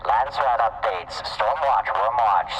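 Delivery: loud, high news promo voice; news announcer. Acted. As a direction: pitch the news like a radio promo. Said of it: "make some announcements".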